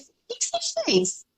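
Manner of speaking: very high, soft amazed question; hushed, high-pitched curiosity; astonished. Retold or imitated. Coach asking the client what she did, astonished.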